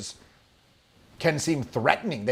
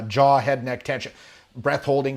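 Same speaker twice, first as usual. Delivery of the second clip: ordinary talk, nothing marked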